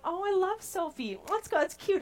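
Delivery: high-pitched cooing over a cute name; mock gushing. Mock parent picking a cute name.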